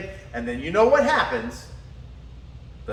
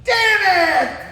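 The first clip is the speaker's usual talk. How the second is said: high mock yell of frustration; hammed-up wake-up curse; imitated angry curse; 10 semitones higher, 6 dB louder than usual. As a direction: blurt it like you just woke up furious. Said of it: "She would be pissed".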